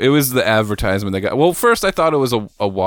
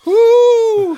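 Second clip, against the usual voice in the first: excited whoop; 6 dB louder than usual. Real, not acted. Cheer after a strong shot.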